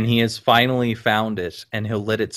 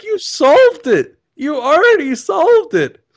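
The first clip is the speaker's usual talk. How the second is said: high, loud yell of disbelief, retold; exasperated shout; 8 dB louder than usual. Retelling own exasperation at the gamer.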